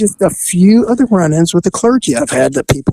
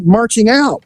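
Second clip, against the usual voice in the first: ordinary talk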